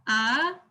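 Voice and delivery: AH rising in pitch; second tone. Shown as a demonstration. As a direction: lift "ah" upward like a question. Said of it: "The second tone is rising".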